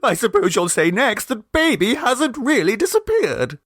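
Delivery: high mother's voice with a mild flash of temper; tearful temper. A narrator's reading. Mrs Mummerby bristles at Miss Gilpert, mild tearful temper. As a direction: snap back through tears, lightly. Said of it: "temper began to shine out through her grief".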